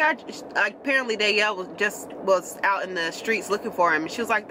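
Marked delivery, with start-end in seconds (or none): none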